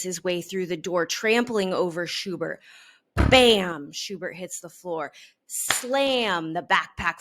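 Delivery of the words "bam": punchy sound word, loud; loud bam. A narrator's reading. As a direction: smack the word out. Sound effect in story.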